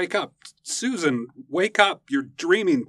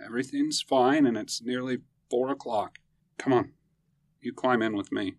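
comforting; 10 semitones lower, 4 dB quieter than usual. Acted. Husband comforting frightened wife.

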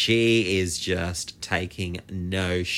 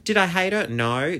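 normal talking voice

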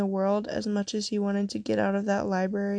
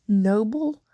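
a louder, rising mock wail; understated wail of disbelief; 5 dB louder than usual. A narrator's reading. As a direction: throw the word back, half crying, voice climbing. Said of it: "Eileen wailed".

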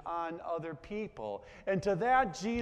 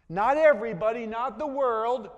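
louder, higher insistence; emphatic contrast; 4 semitones higher, 7 dB louder than usual. Real, not acted. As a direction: hammer home the exclusion.

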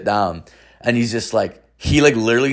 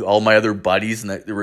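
ordinary delivery, nothing marked

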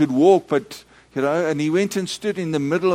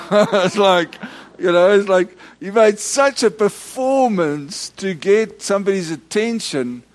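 laughing; 7 semitones higher, 3 dB louder than usual. Real, not acted. Preacher laughing at the story.

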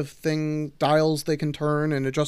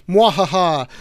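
loud hammy evil cackle; evil villain laugh; 3 semitones higher, 9 dB louder than usual. Pretend. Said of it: "someone ends their turn and goes".

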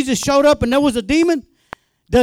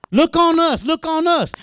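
higher, confident call to the world; bold; 4 semitones higher than usual. Retold or imitated. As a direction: call out with authority. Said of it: "Go out there with boldness".

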